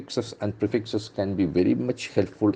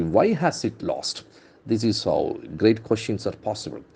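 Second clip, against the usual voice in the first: ordinary talk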